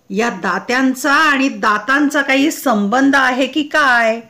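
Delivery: ordinary delivery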